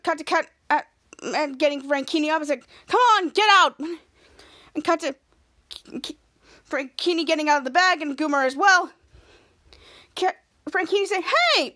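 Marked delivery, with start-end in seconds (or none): 2.9-3.8 higher, louder urging call; mock yell to hurry someone out; retold yelling. Captain Man getting men out of sacks, retold yelling. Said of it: "so they were yelling". 11.2-11.7 high-pitched, stretched exclamation; indignant call. Retold or imitated. Frankini climbing out of the bag, indignant call. Acted protest from freed captive.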